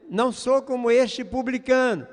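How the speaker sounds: showy praying voice; mock loud prayer. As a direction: proclaim it for everyone to hear, without screaming. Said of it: "gritando e dizendo".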